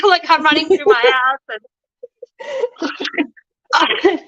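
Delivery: bubbling over with happy laughter; giddy laughing recollection; laughing with joy. Real, not acted. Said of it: "I literally was in heaven".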